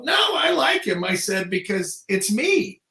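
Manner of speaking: high, loud, emphatic retort; punchline reveal. Retold or imitated. Punchline of the story.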